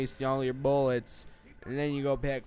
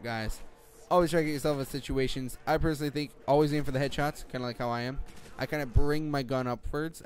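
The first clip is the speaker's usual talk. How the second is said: ordinary delivery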